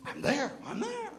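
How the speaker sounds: high, drawn-out comic eagerness; mock eagerness. Flattered author agrees to meet the beginners, comically eager. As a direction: jump at it with a flattered ego.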